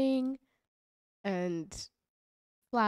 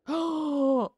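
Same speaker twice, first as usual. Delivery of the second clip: loud surprised gasp; sudden shocked gasp; sharp gasp; 6 semitones higher, 7 dB louder than usual. Real, not acted. Shocked at map marker.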